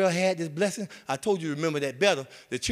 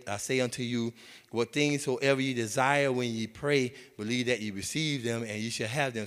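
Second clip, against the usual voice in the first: low, measured King James reading; scripture reading; 5 semitones lower than usual. Acted. Pastor reading Mark eleven to the church, measured and solemn. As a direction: read it like holy writ.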